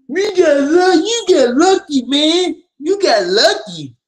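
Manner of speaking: very high whining mock voice with a fake sob; whiny mockery. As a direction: squeal it back at him like a crybaby. Mocking rival's lucky claim.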